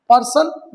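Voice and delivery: Person said loud with a rising tune; headword called out. Shown as a demonstration. Teacher calls out person loudly as the next word. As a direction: announce person clearly to the class. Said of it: "teacher announces headword".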